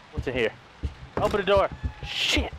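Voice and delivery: normal talking voice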